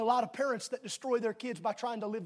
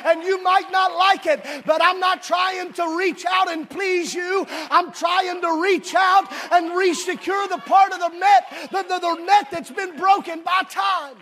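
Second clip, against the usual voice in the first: fervent preacher's call, raised; preaching; 6 semitones higher, 9 dB louder, slower than usual. Real, not acted. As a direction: preach it out with full force. Preaching at full force.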